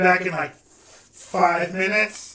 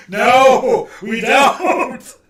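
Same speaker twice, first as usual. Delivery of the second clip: loud protest; 7 semitones higher, 9 dB louder, much slower than usual. Real, not acted. Refuses the group's demand.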